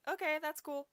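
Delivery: mock-casual okay; breezy brush-off. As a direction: wave it away easily. Jefferson brushing them off.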